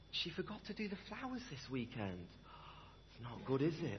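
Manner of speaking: hushed, tutting church gossip. Retold or imitated. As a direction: whisper it like juicy news behind a hand.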